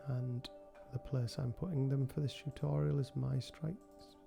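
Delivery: ordinary delivery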